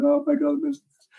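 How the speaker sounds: high-pitched mock wail; mock crying. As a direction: wail it in an exaggerated high voice, sending up your own tears. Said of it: "crying away, going".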